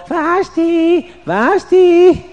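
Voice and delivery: very high calling out; calling down the hall. Acted. King calls for Vashti in the hall, very high. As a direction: call her name down the hallway.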